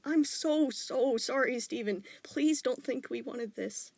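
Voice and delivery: high, slow, choked sorry; tearful apology. A narrator's reading. Father hugging his son, tearfully sorry. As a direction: hold back tears as you apologize. Dad apologizing, blinking tears.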